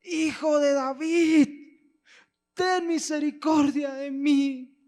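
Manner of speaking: very high, very slow, loud desperate shout. Acted. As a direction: scream for mercy with everything left. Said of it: "él grita más fuerte".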